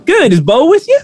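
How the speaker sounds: high, bright-pitched motherly shopkeeper; shopkeeper Joanne's voice. Acted. Shopkeeper greeting the player character in a high voice. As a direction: coo at her like a fond auntie.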